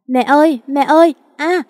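high, loud frantic calls for mother; a lost little girl crying out for mom; lost child calling her mother, then a yelp as she trips. Acted.

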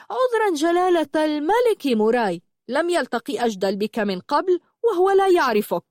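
high-pitched mock-deferential sneer; sarcastic mockery. Mocking Muray as if he were royalty, sarcastic. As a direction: overdo the fake apology with sarcasm. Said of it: "وقال ساخرا ومتهكما".

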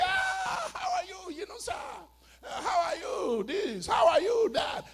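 high, exuberant shouted hello; excited greeting. Acted. Acted excited greeting in a dream.

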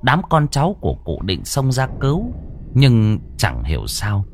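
usual speaking voice

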